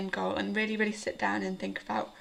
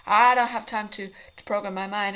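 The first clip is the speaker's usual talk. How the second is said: louder, fretful mock thoughts; harried inner voice; 9 dB louder than usual. Vlogger mimicking her morning thoughts to viewers, harried. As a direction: imitate your own morning fretting out loud.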